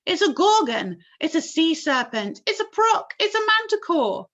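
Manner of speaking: high loud overlapping guesses; frightened shouts. A narrator's reading. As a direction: shout each guess in alarm. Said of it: "all started shouting at once".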